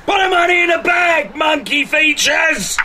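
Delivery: shouting armed robber, fast and rough; bank robber. Acted. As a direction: bark it like a hardened robber. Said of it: "that's very good".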